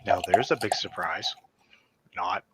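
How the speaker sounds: whispered, dry and sarcastic. Real, not acted. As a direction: breathe it out as a sly aside. Sarcastic aside about friend.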